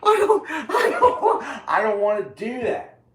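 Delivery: very high laughing wail easing downward; breaking up. Real, not acted. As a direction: choke the words out through high laughter. Breaks down after the screaming rant.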